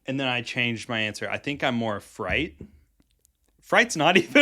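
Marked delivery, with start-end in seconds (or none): 3.6-4.4 high, loud, laughing disbelief; laughing objection. Real, not acted. Friends catching the mistaken word fright, laughing objection. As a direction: object while cracking up.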